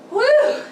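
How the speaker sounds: whoop of relief. Real, not acted. End of tiring ab series.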